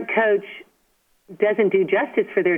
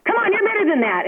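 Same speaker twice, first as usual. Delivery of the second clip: high, fast mock scolding of a daughter; mock yelling coach dad; 13 semitones higher, faster than usual. Coach dad mock-scolding his daughter from the dugout. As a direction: snap at her like a pushy parent-coach. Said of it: "start yelling at their daughter or raising their voice".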